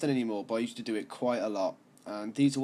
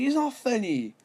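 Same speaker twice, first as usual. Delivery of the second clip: put-on annoyed girl; 12 semitones higher, 4 dB louder than usual. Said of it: "She's like".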